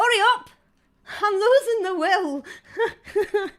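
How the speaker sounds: high, loud call off-mic. Real, not acted. Host calls to the person fetching the door, shouting. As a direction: shout to hurry them along.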